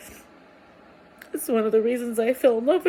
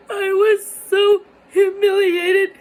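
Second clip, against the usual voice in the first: higher, louder, voice breaking into tears; crying; 6 semitones higher, 7 dB louder than usual. Real, not acted. Telling viewers about being left alone, crying with a higher, louder voice. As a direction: let it crack as you cry it out.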